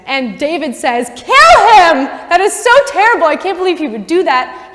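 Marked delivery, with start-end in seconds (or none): 1.1-4.7 high, loud outburst of indignation; acted outrage. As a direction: explode with hammed-up fury, then ease slightly. David's outraged verdict on the man.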